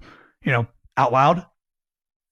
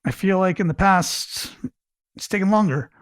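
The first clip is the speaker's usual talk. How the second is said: questioning; 6 semitones higher than usual. Shown as a demonstration. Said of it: "In the example we just gave".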